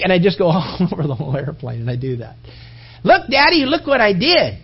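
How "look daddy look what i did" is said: put-on high eager child calling Daddy; proud little kid. Small son showing dad his hammer dents, high and eager.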